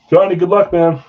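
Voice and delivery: loud, high good-luck shout; a bright loud well-wish to Johnny; cheering him on. Real, not acted. Cheering a player on.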